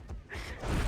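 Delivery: soft, airy laugh; hushed chuckle mid-game. Real, not acted. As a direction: laugh quietly, pleased with the team. Laughs at how strong they are.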